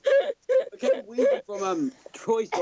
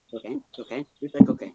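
ordinary talk, nothing marked

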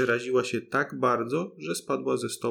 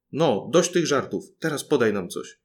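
usual speaking voice

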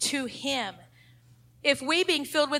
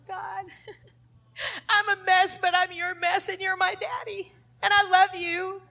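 laughing through tears; 6 semitones higher than usual. Acted. Modelling a broken, humble prayer.

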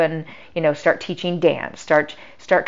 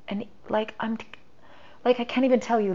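ordinary talk

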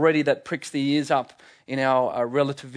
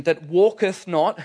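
slow, louder solemn reading of walketh not; King James reading; 2 semitones higher, 4 dB louder, slower than usual. Shown as a demonstration. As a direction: intone "walketh" as archaic scripture. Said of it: "I read this from the King James Version".

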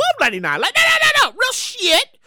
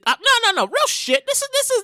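usual speaking voice

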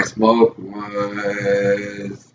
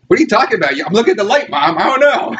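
high, nervous playing dumb; feigned innocence, higher; 11 semitones higher than usual. Son lying to mom about red eyes, higher nervous voice. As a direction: act innocent while panicking. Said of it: "I'm like".

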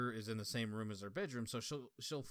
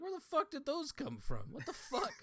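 high, incredulous swearing; baffled outburst; 14 semitones higher than usual. Retold or imitated. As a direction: act stunned that beetles appeared. Baffled by beetles in dream.

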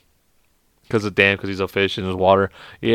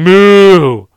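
loud, drawn-out moo; interrupting cow; 7 semitones higher, 11 dB louder than usual. Acted. Cow cutting in on a knock-knock joke, loud moo. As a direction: moo long and loud over the other person.

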